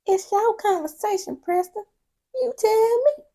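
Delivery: high, loud sassy talk-back; sassy retort. Real, not acted. Viewer answering the man on screen, sassy and high. Mocking the show couple.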